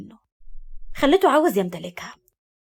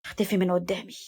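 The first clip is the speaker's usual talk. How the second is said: softly spoken dismissal; hushed order; 3 semitones lower, 6 dB quieter than usual. A narrator's reading. Man ordering her out of his sight, hushed. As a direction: send her away under your breath. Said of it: "قال لها بهمس".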